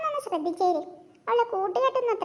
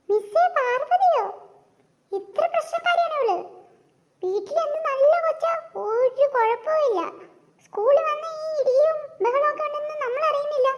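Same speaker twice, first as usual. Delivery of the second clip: surprised mother; 6 semitones higher than usual. Acted. Mother surprised at teacher's report.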